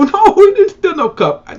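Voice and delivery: high, loud indignant denial; indignant brothers. Retold or imitated. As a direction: protest the accusation, shocked. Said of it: "And they go".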